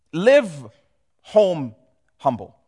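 each word set apart by pauses; Live, home, humble, with a pause after each word; slow spaced-out emphasis. Shown as a demonstration. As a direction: let each word land on its own. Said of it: "That's the simplest way".

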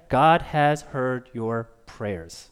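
very slow, measured prophetic message; solemn, spaced-out announcement; slow pronouncement. Acted. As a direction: deliver each word slowly and with weight. Prophet's answer, then repeated softly.

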